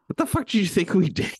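high, loud incredulous outburst; mock indignation. Real, not acted. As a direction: throw it back in playful outrage, breaking into a laugh. Mock outrage at co-host's surprise.

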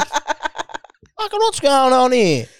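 high, slow hammed-up outrage; mocking a seething caller; mock outraged bloke. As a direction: stretch it out like an indignant bloke. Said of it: "Yeah, dude".

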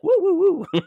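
high, loud party whoops; imitated woo-girl mom. Host imitating the film's mom who was full of life, whooping. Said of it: "her mom was like".

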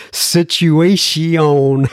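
put-on "Situation" stretched out in a jokey French accent; mock French accent. Host reading a listener's spelling, mock French accent. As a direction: say "Situation" very slowly as if it were French. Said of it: "He's doing a little French".

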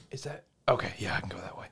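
very soft, half-whispered uncertainty; hushed muttering. Retold or imitated. Player unsure of the way in a dark game, hushed muttering. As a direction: mumble it under your breath, barely audible.

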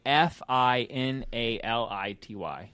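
spelled out letter by letter; slow spelling. Shown as a demonstration. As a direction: spell out each letter slowly. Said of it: "spelling the album name".

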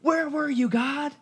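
high, mock-whiny cry to God; a high sarcastic imitation of a complaint. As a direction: whine it like a let-down believer. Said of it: "we're like".

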